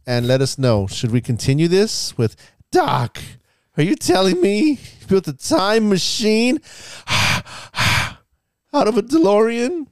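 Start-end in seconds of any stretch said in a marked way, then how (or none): 2.7-9.9 high, slow pleading Marty McFly; high disbelieving teen voice; Marty impression. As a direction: gape at Doc in a high, stretched voice. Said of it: "continue to do impressions".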